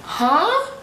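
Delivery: high, baffled huh; confused huh. Real, not acted. A high, confused huh to the camera. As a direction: sound truly lost.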